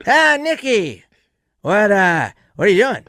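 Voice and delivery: Norm impression. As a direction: pitch it way up and stretch the greeting like an old friend. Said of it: "he goes".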